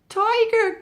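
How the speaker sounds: high, loud gasp of fright; startled frightened gasp. Acted. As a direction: gasp it in sudden terror. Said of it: "gasped Mouse Deer".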